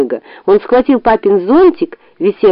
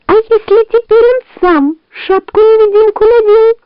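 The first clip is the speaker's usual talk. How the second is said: high, anxious fox voice; worried fox; 14 semitones higher, 3 dB louder than usual. A narrator's reading. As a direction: fret it out, alarmed. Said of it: "снова забеспокоилась лиса".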